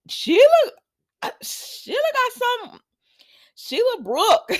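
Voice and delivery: very high, loud squeal of amazement; giddy high-pitched outburst; amused exclamation. Real, not acted. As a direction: exclaim way up high, breaking into laughter. Her own amused exclamation about Sheila.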